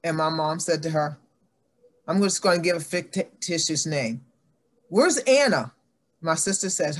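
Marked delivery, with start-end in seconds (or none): 4.9-5.7 high, louder call across the house; a mother's sharp call. Retold or imitated. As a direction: call out sharply for the missing child. Mother calling for her child.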